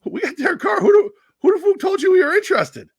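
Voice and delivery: high, loud, indignant outburst. Retold or imitated. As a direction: yell it like an offended boss.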